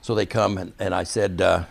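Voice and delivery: normal talking voice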